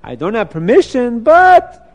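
dismissive voice of temptation, starting with a drawn "Ah"; tempting inner voice. Acted. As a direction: shrug off the rule, rising to a loud "but". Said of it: "Another voice came in his head".

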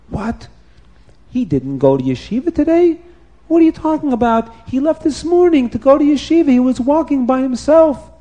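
high, fast panicked father; alarmed father. Acted. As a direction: rush it out like a frightened dad. Father alarmed at missing son.

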